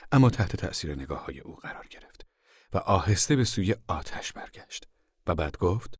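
usual speaking voice

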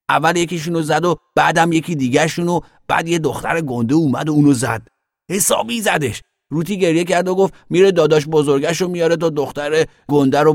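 put-on high, loud child voice; excited little boy telling tales. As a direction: blurt out the story like an eager kid.

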